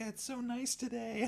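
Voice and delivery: high, soft chirpy small talk; mock cheeriness. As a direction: put on a sweet, high-pitched pleasantry. Acting out outwardly cheerful comic character.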